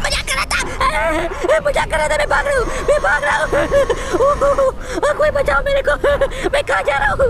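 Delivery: high, loud mock-terrified wailing; hammed-up panic. To the scary game child, a hammed-up terrified wail. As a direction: ham up a cry for help while running away.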